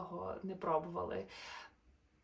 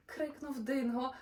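lively, sing-song storybook narration; animated bedtime-story voice; reading to children; 5 semitones higher, 4 dB louder than usual. A narrator's reading. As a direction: read the rhymes brightly to little listeners. Reads a rhyming children's story.

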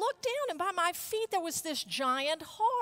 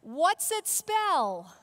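slow, louder call and answer; cheerleader call; 5 dB louder, much slower than usual. Shown as a demonstration. Cheerleader asking the squad, drawn-out loud call. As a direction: drag the question out for the crowd. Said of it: "here's what they did".

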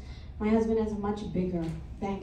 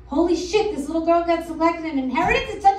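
mock envious outburst; 5 semitones higher, 6 dB louder than usual. Mock envious second thought.

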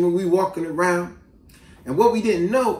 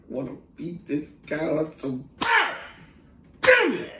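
bottled-up fury; 2 semitones lower, 4 dB quieter than usual. Retold or imitated. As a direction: hold the rage in, then let it squeak out. Retold bottled-up fury after water splash.

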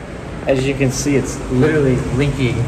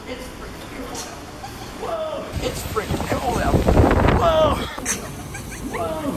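wind on mic; 4 dB quieter than usual. Real, not acted. Out in the storm, wind roaring.

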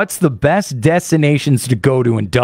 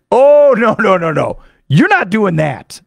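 imitated refusal; 4 semitones higher, 4 dB louder than usual. Locals refusing a wine order.

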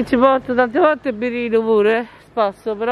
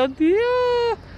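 surprised; 13 semitones higher than usual. Real, not acted. Startled exclamation, very high.

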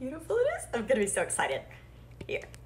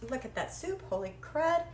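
normal talking voice

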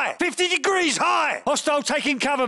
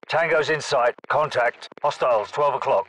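much lower, steady military callout voice; a lower-voiced squad soldier calling targets; 12 semitones lower than usual. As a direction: report enemy positions in a low, even voice. Low-voiced soldier calling out enemies.

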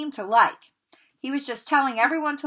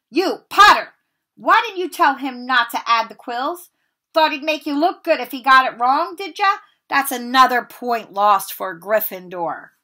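much louder, higher furious accusation; angry Snape; 4 semitones higher, 9 dB louder than usual. Acted. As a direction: round on the boy and blame him. Snape rounds on Harry.

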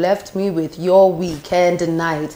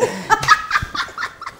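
high breathy laugh; 3 dB quieter than usual. Real, not acted.

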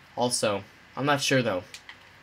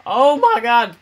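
startled shout; 10 semitones higher, 11 dB louder, slower than usual. Real, not acted. Startled in game.

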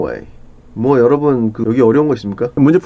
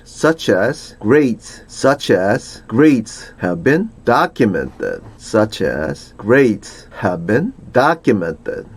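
slow read-aloud; slower than usual. Shown as a demonstration. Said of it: "teacher reads slowly for repetition".

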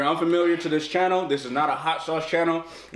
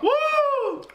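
excited whoop. Real, not acted. Reaction to the pepper.

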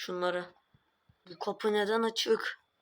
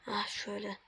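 whispering while hiding; 5 dB quieter than usual. Acted. Hiding, ducking down.

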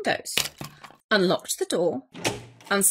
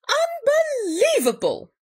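high, exasperated outburst; indignant Bear; 14 semitones higher than usual. A narrator's reading. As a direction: stretch it out in outraged disbelief. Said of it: "rumbled Bear".

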